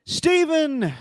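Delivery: high shouted name, clean; loud call. Real, not acted. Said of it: "Take two".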